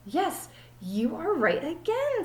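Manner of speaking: high, slow ditzy teacher voice; drawn-out, high and relieved; silly teacher. Acted. Teacher greets Allison in the empty classroom, high and slow.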